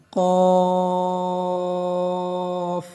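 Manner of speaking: কফ held long, half sung; chanted কফ; drawn-out qaf. Shown as a demonstration. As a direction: stretch কফ four alif lengths. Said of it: "চার আলিফ টান হবে".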